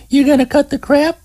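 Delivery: high quick woman's voice, demanding; stern Terry. Acted. Terry makes hanging Scott promise to behave, high demanding voice. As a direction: make him promise before you help.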